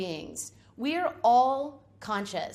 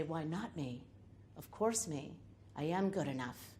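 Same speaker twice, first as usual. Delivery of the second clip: much lower and much softer, calm and certain; hushed, low, steady reassurance to yourself; higher self voice; 5 semitones lower, 10 dB quieter than usual. Acted. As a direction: speak like a quiet, sure inner voice. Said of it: "listen with your higher self voice and say".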